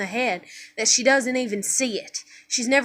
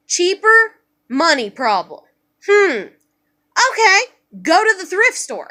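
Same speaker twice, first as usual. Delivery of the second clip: put-on child self reasoning aloud; 4 semitones higher, 3 dB louder, slower than usual. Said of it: "And I'm like".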